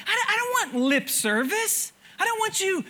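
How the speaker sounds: voicing God. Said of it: "That, that's God saying".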